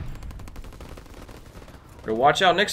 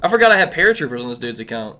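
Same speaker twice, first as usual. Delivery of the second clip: normal talking voice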